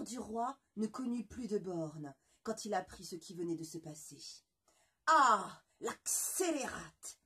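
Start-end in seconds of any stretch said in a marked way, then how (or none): 5.1-7.2 loud, slow furious exclamation; outraged cry. Acted. Le roi furieux s'écrie devant ses courtisans. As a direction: explode in rage. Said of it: "s'écria-t-il".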